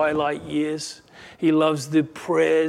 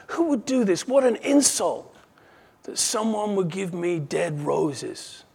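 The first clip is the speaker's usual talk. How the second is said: high, affronted exclamation, slowing at the end; acted outrage at an insult; indignant; 4 semitones higher than usual. Girl insulted by dead roses.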